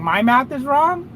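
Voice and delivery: can't believe he's saying my math is off; incredulous echo. Real, not acted. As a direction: repeat the accusation back in disbelief.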